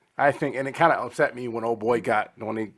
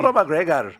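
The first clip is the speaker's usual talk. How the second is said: put-on Irish accent; 6 semitones higher, 5 dB louder than usual. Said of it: "that was it right there".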